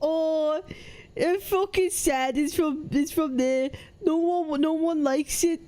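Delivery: mocking Brummie impression; Birmingham accent. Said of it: "where they talk like this from Birmingham".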